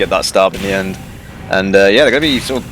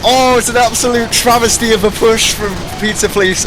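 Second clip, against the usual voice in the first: excited; 10 semitones higher than usual. Real, not acted. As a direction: yell it like a clutch save. Caster reacting to a clutch escape.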